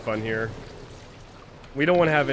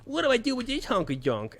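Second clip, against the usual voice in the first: confused gamer; 12 semitones higher than usual. Retold or imitated. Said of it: "They were like".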